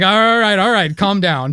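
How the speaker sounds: high, loud, backing off; calm him down, nervous and loud; mock alarmed teacher. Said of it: "he was like".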